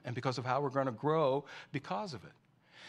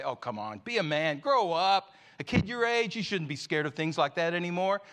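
louder, higher, hammed-up scold; mock scolding; 5 semitones higher, 7 dB louder than usual. Preacher voicing a harsh father scolding his son, mock loud. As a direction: sneer at the boy in play.

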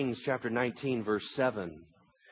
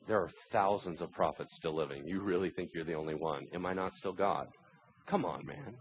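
low, soft voice of God; calm reproof; 5 semitones lower, 5 dB quieter than usual. Acted. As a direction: answer the panic with quiet patience. God calmly answers frantic Elijah.

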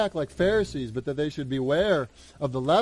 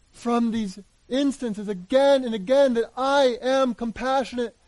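Jesus pleading with his disciples in the boat, higher and insistent; Jesus rebuking; 5 semitones higher than usual. Retold or imitated. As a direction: press them tenderly but firmly, like a teacher.

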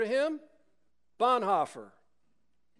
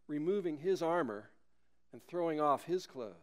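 usual speaking voice

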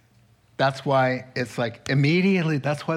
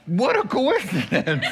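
sarcastic quip; 5 semitones higher than usual. Acted. Sarcastic reply with laughter.

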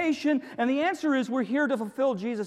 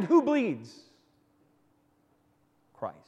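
ordinary talk

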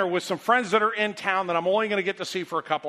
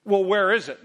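impatient snapping; 2 semitones higher, 7 dB louder than usual. Retold or imitated. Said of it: "how's that for a brilliant question".

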